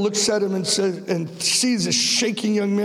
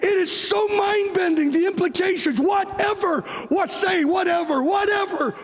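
full-force preaching; 10 semitones higher than usual. Acted.

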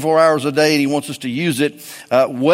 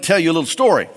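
normal talking voice

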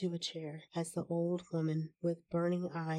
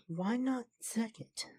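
mildly feverish, higher-pitched challenge; a touch of feverish insistence; 5 semitones higher than usual. A narrator's reading. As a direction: press the question eagerly, pitched up. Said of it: "said the old woman feverishly".

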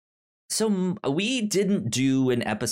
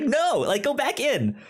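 pleading with the earbud; 13 semitones higher than usual. Retold or imitated. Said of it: "It's just like".

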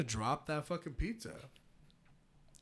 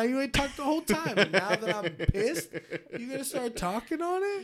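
high, loud, half-laughing rant; laughing mock anger; 10 semitones higher, 7 dB louder than usual. Real, not acted. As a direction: scold him but crack up doing it. Mock-angry at silent friend.

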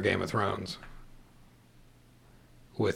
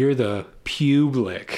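slow emphatic; 2 semitones higher, much slower than usual. Pretend.

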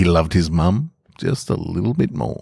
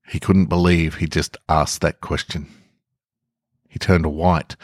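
usual speaking voice

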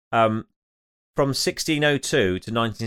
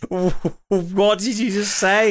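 mock disbelief; 7 semitones higher, slower than usual. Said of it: "I was like".

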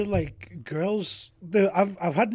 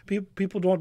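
usual speaking voice

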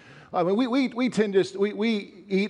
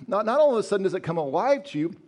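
usual speaking voice

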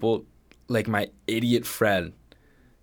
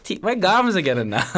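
put-on high, loud old nonna voice; Italian grandma voice; 9 semitones higher, 5 dB louder than usual. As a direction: squawk it like a nonna.

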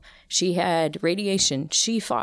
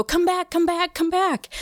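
calling out; 14 semitones higher than usual. Retold or imitated. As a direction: call the boy back across the house. Calling son back to room.

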